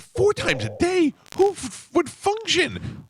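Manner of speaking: patient shocked at doctor's dose, high slow disbelief; incredulous. Retold or imitated. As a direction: stretch it out in mock shock. Retold reaction to Xanax dose.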